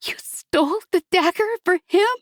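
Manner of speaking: a cry of betrayal pitched high; hurt accusation. A narrator's reading. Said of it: "her voice thick with pain and betrayal".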